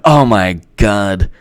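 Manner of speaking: slow, drawn-out sarcastic wonder; exaggerated sarcastic reverence; mock awe. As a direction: stretch every word as if deeply moved. Sarcastic praise of the speech.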